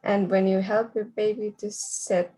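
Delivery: ordinary talk, nothing marked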